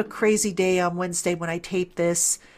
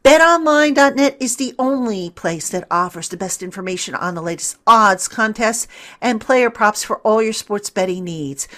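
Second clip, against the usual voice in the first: ad read; 2 semitones higher, 8 dB louder than usual. Acted. Podcast host reads a betting sponsor spot, loud hard-sell ad voice. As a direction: sell it like a radio spot, every line pushed.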